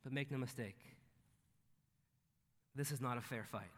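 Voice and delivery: ordinary talk, nothing marked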